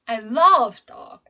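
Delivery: "love" swelling loud and long; stress on LOVE. Shown as a demonstration. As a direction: put all the weight on "love". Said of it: "because my stress is on different word".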